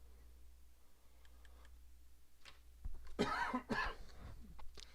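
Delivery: long coughing fit; drawn-out sick coughing. Real, not acted. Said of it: "I'm coughing on stream".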